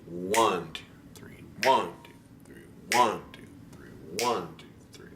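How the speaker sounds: ONE leaned on, two and three dropped away; emphasis on the downbeat. Shown as a demonstration. Piano teacher showing the student the waltz downbeat. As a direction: lean hard on each ONE. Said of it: "there's a real emphasis on that downbeat".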